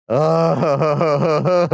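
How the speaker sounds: high, wobbling "oh-ho-ho"; overwhelmed laughing hoot; shaky laughing groan. Real, not acted. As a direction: laugh out a long, stuttering "ooh". Reacts to the fish sauce.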